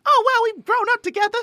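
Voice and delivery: high-pitched British best friend; British accent. Acted. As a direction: put on a squeaky-high mock British accent. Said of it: "give in to the, uh, like, Britishisms".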